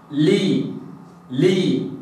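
لي with the tongue in place, then the lips spread; lam with kasra. Shown as a demonstration. Teacher demonstrating lam with kasra, لي drawn out. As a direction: hold the tongue, then break to لي. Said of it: "أكسر".

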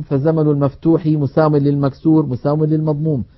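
ordinary talk

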